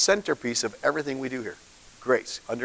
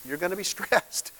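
amused repeat with a laugh; 5 semitones higher, 5 dB quieter than usual. Shown as a demonstration. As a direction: laugh breaking into the repeated word.

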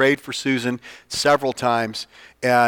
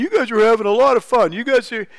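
much higher and louder, gushing; mock admiring bystander; 10 semitones higher, 4 dB louder than usual. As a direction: imitate amazed bar patrons. People in bar commenting.

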